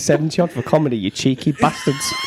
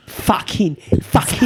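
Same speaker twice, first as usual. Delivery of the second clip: higher, hammed-up Cockney; Cockney accent; 5 semitones higher, slower than usual. Shown as a demonstration. Trying Cockney for mates, high-pitched mock Cockney. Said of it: "Can you do Cockney?".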